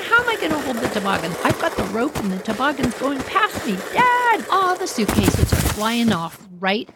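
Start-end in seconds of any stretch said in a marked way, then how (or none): 0.1-3.8 high, rushed, exasperated reply; flustered protest. Acted. Arguing over who can hold the runaway toboggan, flustered and high. 3.9-4.4 high-pitched alarmed shout; calling for Dad. Acted. Child calls Dad as the toboggan gets away, high alarmed cry.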